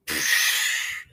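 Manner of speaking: a breathy psh snore sound; mock snore. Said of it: "sometimes it sounds like".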